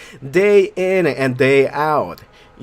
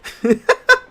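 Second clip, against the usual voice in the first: high giggle; 4 dB louder than usual. Real, not acted. Laughs at own odd reading.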